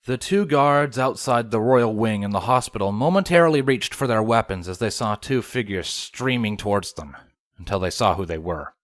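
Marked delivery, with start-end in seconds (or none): none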